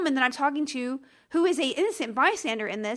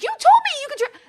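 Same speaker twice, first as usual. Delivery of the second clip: high, loud retold outburst; outraged protest; 9 dB louder than usual. Customer told she misheard about a transfer, outraged. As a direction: explode in indignant disbelief. Retold quarrel with phone agent.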